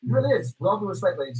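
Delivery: usual speaking voice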